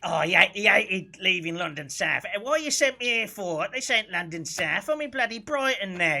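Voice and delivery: loud, high South London grumbling; South London accent. Acted. Imitating an engineer sent out of London, South London accent. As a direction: moan loudly in a broad South London accent. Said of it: "he spoke like this".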